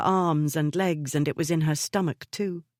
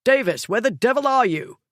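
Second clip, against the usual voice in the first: loud and higher, calling out; impatient yell; 7 semitones higher, 5 dB louder than usual. A narrator's reading. Radford shouting through the house for the maid, impatient yell. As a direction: bellow up the stairs for her.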